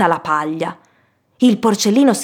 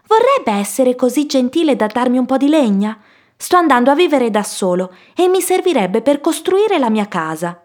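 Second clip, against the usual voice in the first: high-pitched piglet voice; lifted, courteous storybook piglet; polite middle pig; 5 semitones higher than usual. Acted. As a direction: ask a favour like an earnest young pig. Middle pig asks for wood.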